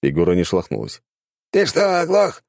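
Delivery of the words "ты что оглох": angry. A narrator's reading. Angry guard yells at prisoner.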